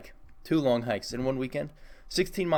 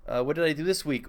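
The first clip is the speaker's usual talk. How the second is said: ordinary talk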